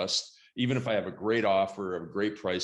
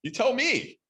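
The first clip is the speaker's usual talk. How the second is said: mock retort; 10 semitones higher, 6 dB louder than usual.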